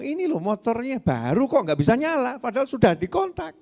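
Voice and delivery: a light voicing of a baffled uncle; puzzled uncle. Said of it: "Terus ngomong".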